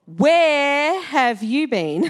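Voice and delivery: slow, stretched-out parental scolding; drawn-out mock telling-off; cross waiting parent. Shown as a demonstration. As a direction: demand it like a parent of a late child. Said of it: "a parent whose child is getting home later".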